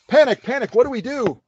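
hammed-up flustered cry for help; mock panic. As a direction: call out in pretend panic. Mock alarm when album ends.

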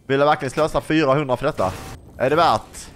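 ordinary talk, nothing marked

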